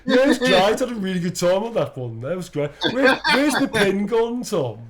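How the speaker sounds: put-on loud, high broad Birmingham accent; thick Birmingham accent. Unmuted host chatting to Tom, loud thick Birmingham accent. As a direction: bellow it in thick Brummie. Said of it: "the thickest Birmingham accent you've ever".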